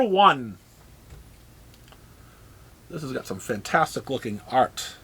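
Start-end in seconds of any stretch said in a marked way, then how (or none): 2.9-4.9 dry, quiet sarcasm; deadpan praise, softer, lower; sarcastic. Acted. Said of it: "I'm letting you know I'm being sarcastic".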